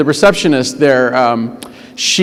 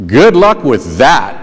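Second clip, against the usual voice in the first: dry, doubtful good-luck; a slow, low sarcastic brush-off; 4 semitones lower, slower than usual. Retold or imitated. Receptionist to the new substitute, slow and sarcastic.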